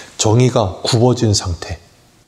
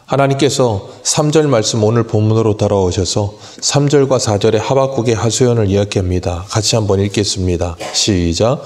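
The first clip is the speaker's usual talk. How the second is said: ordinary talk, nothing marked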